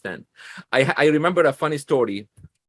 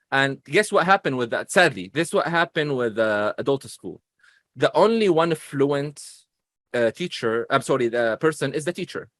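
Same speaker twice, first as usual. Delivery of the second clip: ordinary talk, nothing marked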